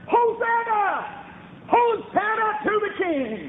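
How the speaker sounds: high, drawn-out acclaiming cry; hollering crowd. Retold or imitated. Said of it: "they were hollering".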